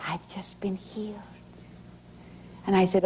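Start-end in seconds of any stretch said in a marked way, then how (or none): 0.1-1.2 soft, breathy near-whisper; timid. Retold or imitated. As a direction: say it shyly, barely above a whisper. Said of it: "very timidly she said".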